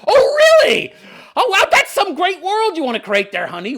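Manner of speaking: laughing sarcasm at a tyrant's plan; sarcastic. Real, not acted. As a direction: scoff at her with mock amazement. Sarcastic retort to Dany.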